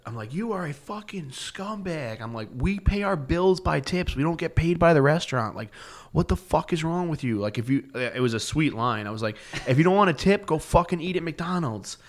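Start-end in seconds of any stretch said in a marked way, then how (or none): 0.3-7.4 enraged rant. Retold or imitated. Yelling at the rude customer in a rage. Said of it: "a fit of rage". 9.7-12.0 high-pitched furious jab; angry retort. Retold or imitated. Telling off the stiffing customer, angry and high. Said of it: "a fit of rage".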